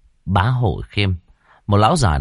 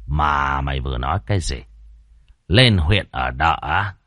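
cold landlord; 4 semitones lower than usual. Acted. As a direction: brush off the crying debtor coldly. Landlord scolds debtor. (lão Khiêm lạnh lùng khinh khỉnh)

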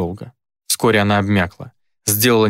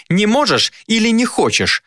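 much higher, challenging demon; mocking taunt; 12 semitones higher than usual. A narrator's reading. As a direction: needle him about his fear.

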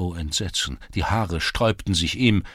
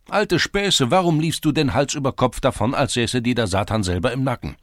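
loud mockery; 7 semitones higher, 6 dB louder than usual. A narrator's reading. Lads mock his excuse.

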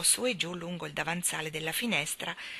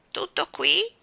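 high, louder let-down question; disappointed bird voice; 11 semitones higher, 7 dB louder, slower than usual. Acted. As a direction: ask it in dismayed disbelief, pitched up. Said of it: "chiese lo stornello deluso".

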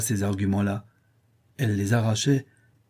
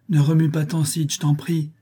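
higher-pitched suffering plea; pained pleading; 6 semitones higher than usual. A narrator's reading. Boy playing sick to his brother, pained pleading. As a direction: beg as an ailing boy would.

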